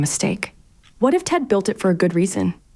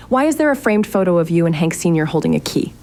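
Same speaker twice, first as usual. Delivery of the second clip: normal talking voice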